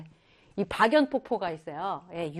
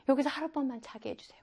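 quiet plea; 3 semitones higher, 7 dB quieter, faster than usual. Shown as a demonstration. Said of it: "그랬죠?".

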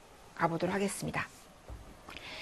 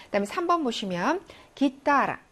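ordinary talk

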